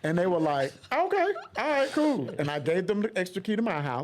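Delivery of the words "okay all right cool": high, loud casual agreeing; breezy friends. Retold or imitated. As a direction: toss it off like it's nothing. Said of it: "Did they say it like that for real?".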